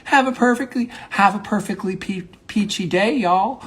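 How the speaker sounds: high, sunny Southern send-off; playful Southern. Pretend. Monologist playing someone in Georgia saying goodbye, cheery Southern. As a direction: put on a cheery Georgia accent, pitched high. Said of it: "sort of like a Southern thing".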